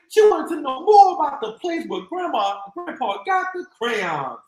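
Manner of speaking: ordinary delivery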